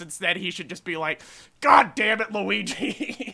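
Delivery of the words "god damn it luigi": exasperated Italian-American plumber, loud outburst; Mario. Acted. Podcaster imagines Mario cursing at his brother, loud mock exasperation. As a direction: curse out your brother, louder, in Brooklyn-style Italian-American. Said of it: "the Italian American voice".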